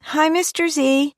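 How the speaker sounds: put-on high, cheerful child voice; a young girl waving hello; little girl voice. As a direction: daughter greeting her mother's date, high child voice. Daughter Mary waving.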